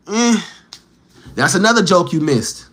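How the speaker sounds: ordinary delivery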